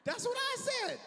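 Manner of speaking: high, loud mock outrage; incredulous outburst. Retelling the snitching passenger, high loud outrage. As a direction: blurt it out, stunned.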